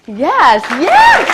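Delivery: excited yes. Real, not acted. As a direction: whip the crowd up with a loud yes. Cheering on the crowd.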